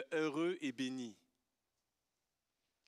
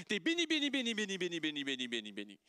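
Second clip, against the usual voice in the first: mock gushing repetition; much faster than usual. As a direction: reel it off in one long descending run. Mocking the modern notion of blessed.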